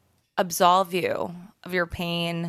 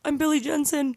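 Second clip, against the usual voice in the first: dumb guy voice; 7 semitones higher than usual. Retold or imitated. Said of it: "do my voice".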